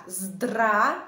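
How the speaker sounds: "zdra" as one loud, drawn-out syllable; syllable demo. Shown as a demonstration. Teacher shows learners the word's first chunk, "zdra" drawn out. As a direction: say "zdra" long and open, ending on the vowel. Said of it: "Je prononce comme ça".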